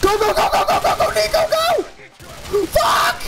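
very high, real frantic scream; shrill all-out panic yell; panicked screaming. Real, not acted. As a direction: scream your lungs out urging the driver on. Frantic escape in the game.

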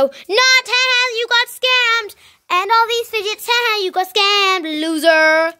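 high, mocking laugh-filled jeer; gloating taunt. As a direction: rub the victory in their face. Taunting the scammed trader.

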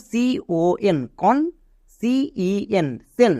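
a teacher sounding out CON কন and CEN সেন, very slow; sounding out letters for a class; slow spelling demo. Shown as a demonstration. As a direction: spell each letter out very slowly, then say the syllable. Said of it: "সঠিক বানান এবার আমরা করতে পারবো".

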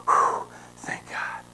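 a dad's hushed sigh of relief; relieved whisper. Retold or imitated. Dad to his son, after catching the bee: slow relieved whisper. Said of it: "and he's just like".

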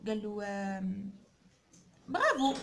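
ordinary talk

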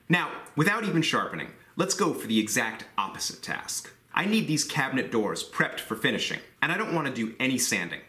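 usual speaking voice

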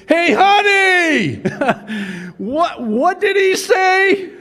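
high, loud, slow call across the room; wife hollering across the church in the middle of the sermon; yelled across the church. Retold or imitated. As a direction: yell out to her husband far away, laughing. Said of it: "she yells out to her husband".

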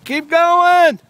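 high, loud encouraging cheer; hoarse marathon cheering. Real, not acted. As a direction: cheer them on from afar. Cheering kid on bike.

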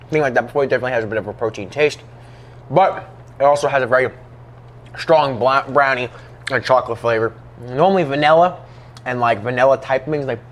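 chewing between words; talking while eating bar; mouth partly full. Real, not acted. Seen 1: hand over mouth earlier, lips pressed, cheeks working between phrases.

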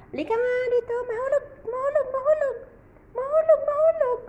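high coaxing pet voice; baby talk to a pet. Acted. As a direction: coax the kitten up high.

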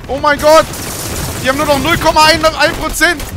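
loud high tense yell; excited shouting. Real, not acted. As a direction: shout in nail-biting suspense. Tense final seconds.

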